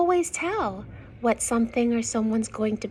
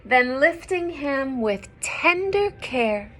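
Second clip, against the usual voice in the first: clear, louder storybook reading; story narration; 7 dB louder than usual. Acted. Reading a picture book to young children, lively narration. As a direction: read the rhyme out to the children.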